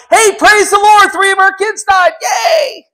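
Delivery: high, loud, mocking fake celebration; mock cheer. Preacher mocks a cheerful church, high and loud. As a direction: ham up an absurd happy cheer. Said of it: "everybody in church is going".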